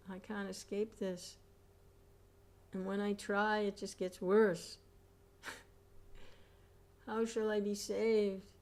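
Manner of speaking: high, pleading lament; desperate pleading. Acted. Preacher voices a sinner pleading in hell, high and desperate. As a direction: beg for escape that isn't there. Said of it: "what does he cry out".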